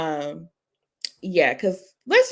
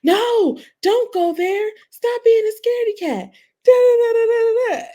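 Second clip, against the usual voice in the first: put-on high, louder child's voice hyping the movie; excited kid voice; 7 dB louder than usual. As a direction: shout it like a thrilled little boy. Son talking to movie screen.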